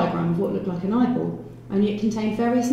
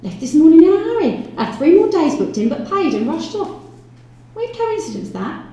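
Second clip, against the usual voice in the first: high, loud landlady voice; chatty landlady; 10 semitones higher, 6 dB louder than usual. Acted. Landlady telling police about a departed lodger, high and chatty. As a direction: gossip in a high, emphatic landlady's voice.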